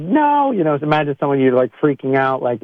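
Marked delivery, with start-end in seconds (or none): 0.0-0.5 sudden high mock outburst; mock angry cry, much higher. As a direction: yelp it out in mock frustration. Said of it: "anger, and it's like".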